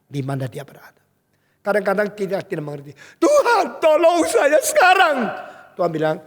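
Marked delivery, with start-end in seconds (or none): 3.2-5.2 high, loud cry for help; dramatized demanding prayer; desperate plea. Acted. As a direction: shout urgently up to God, acting it out. Voicing a desperate plea to God.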